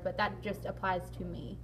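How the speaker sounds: ordinary delivery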